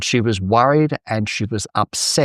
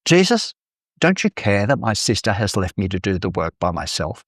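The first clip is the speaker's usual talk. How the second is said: normal talking voice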